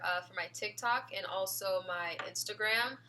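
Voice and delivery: usual speaking voice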